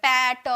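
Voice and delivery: wrong demo of "पैट" as two drawn-out beats; split into two syllables. Shown as a demonstration. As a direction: break "पैट" in two, with an added vowel after the T. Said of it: "ऐसे थोड़ी बोल रहा है".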